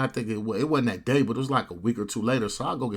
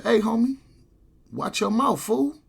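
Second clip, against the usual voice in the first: high, raised-voice challenge; pitched-up confrontational call-out; retold warning; 11 semitones higher, 4 dB louder than usual. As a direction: imitate a man warning someone off. Retold warning before a prison fight.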